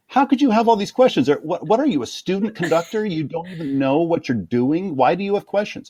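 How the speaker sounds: high, mocking incredulous boss; mock the conductor's scornful disbelief; imagined boss scolding. Imagined music director scoffing at a nervous assistant, high mock scorn. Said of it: "he'll be like".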